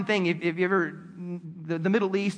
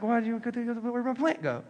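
softer, puzzled voice of a put-out Jonah; mock bewildered Jonah; 5 dB quieter than usual. Jonah finds his beloved plant dead, mock bewildered. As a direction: act lost, looking for the plant. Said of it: "And it's like".

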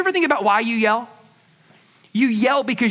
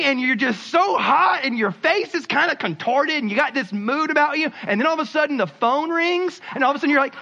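normal talking voice

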